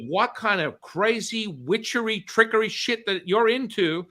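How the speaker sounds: high-pitched mock disbelief; incredulous accusation. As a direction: accuse as a flabbergasted woman. Acting her incredulous accusation.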